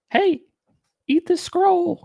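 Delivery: very high mock angel voice; a high silly voice mocking the angel's odd order. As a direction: lampoon the angel in a high squeaky voice. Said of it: "a couple of weird things like".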